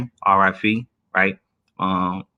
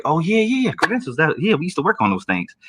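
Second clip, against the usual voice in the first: faked recognition; 8 semitones higher than usual. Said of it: "And I was like".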